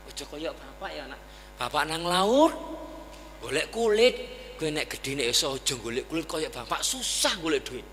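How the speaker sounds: put-on poor mother softly wishing a better life for her child; mother's gentle prayer. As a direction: murmur a hope over the baby. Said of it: "Doa mak kita kan dulu".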